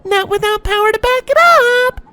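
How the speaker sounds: very high jeering filly. A narrator's reading. Filly laughs at Sunburst's lack of power, jeering. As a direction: jeer it out in a high voice. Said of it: "the silver-maned one jeered out".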